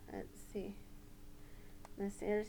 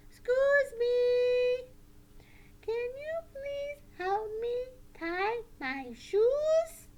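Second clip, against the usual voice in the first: slow, high, clear puppet voice; deliberate high-pitched polite puppet request; strong, respectful puppet; 14 semitones higher, 5 dB louder, much slower than usual. Shown as a demonstration. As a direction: ask politely and firmly as a puppet pupil. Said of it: "show you how to ask in a strong, respectful voice".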